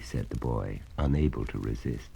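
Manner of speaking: usual speaking voice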